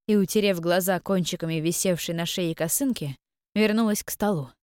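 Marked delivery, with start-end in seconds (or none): none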